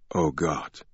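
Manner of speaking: soft, hushed inner thought; softly voiced thought of dread. A narrator's reading. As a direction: say it to yourself under your breath. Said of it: "he thought".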